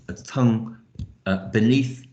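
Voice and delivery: ordinary delivery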